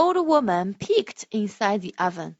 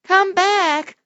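calling out; 8 semitones higher, 4 dB louder than usual. Acted. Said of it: "cried the little old woman".

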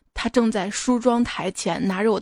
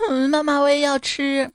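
mock whiny child; 4 semitones higher than usual. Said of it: "哭着说".

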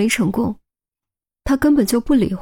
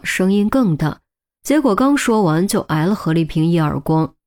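ordinary delivery, nothing marked

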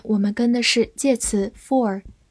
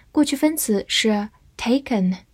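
ordinary talk, nothing marked

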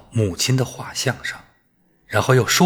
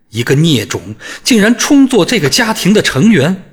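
high, loud, venomous rant; furious outburst; 7 semitones higher, 6 dB louder than usual. A narrator's reading. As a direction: lash out without restraint.

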